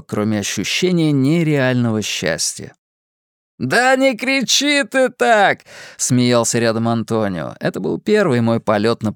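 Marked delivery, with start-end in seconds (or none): 3.6-5.6 higher, slow amused scolding; laughing instructor. A narrator's reading. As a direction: tease a screaming friend. Antonio laughing at screaming narrator. (смеясь, шутливо)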